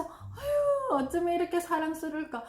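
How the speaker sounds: high, quick gush of affection; gushing praise. Shown as a demonstration. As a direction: gush over your child. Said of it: "그래서". (아이에게 사랑스럽다고 감탄하듯)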